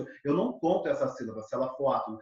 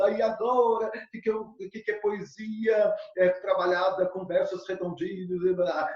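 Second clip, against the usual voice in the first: mock panicked student; 3 semitones higher than usual. Teacher imitating students confused by verse forms, mock despair. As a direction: whine like an overwhelmed student. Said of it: "muitos estejam se descabelando".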